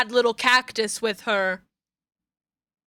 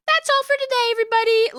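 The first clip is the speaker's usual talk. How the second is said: high sing-song sign-off; 12 semitones higher, much faster than usual. Real, not acted. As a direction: repeat That's all for today much higher, sing-song. Sign-off repeated, sung out.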